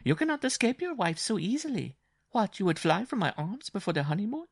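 very high-pitched mocking bride; teasing bride. A narrator's reading. Bride to husband trying to escape, high and teasing.

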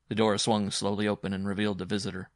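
usual speaking voice

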